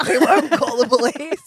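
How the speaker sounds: half-laughing promise. Retold or imitated. Husband reassuring the runner, laughing it out. Laughing while retelling reply.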